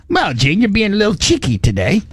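lightly put-on proper British, lifting at the end; mock British talk. Imitating film characters teasing Gene, mock British lilt. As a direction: tease with a hint of British properness. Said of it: "they talk real funny".

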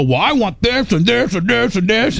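high-pitched, hammed-up string of demands; mock demanding. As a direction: parody your own pushy nagging. Mocks his own string of demands.

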